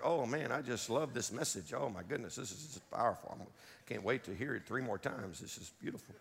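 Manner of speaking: soft, low, sarcastic gushing, trailing to a whisper; mock enthusiasm. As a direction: pretend to love a hard message, deadpan. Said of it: "not like a real happy, cheerful message".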